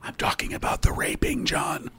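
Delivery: hushed grim whisper. Real, not acted. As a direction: drop to a whisper.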